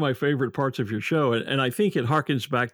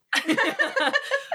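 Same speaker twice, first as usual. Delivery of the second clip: high laugh. Real, not acted. Host laughing at the comical accents.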